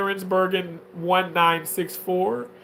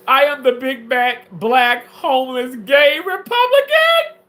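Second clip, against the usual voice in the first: loud high whining list; high whine; 6 semitones higher, 7 dB louder than usual. Acted. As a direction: wail it rising to a squeal. Whining self-description.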